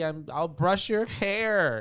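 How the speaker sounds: high, loud, slow possessed cry; demonic yell. Acted. Lily yelling through the bedroom door, demonic. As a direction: stretch it out like a possessed child. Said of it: "yelling on the other side".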